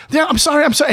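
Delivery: retold flustered sorry, pitched high; imitating a panicked, repeated apology; frantic apology. Retold hurried apology amid screaming.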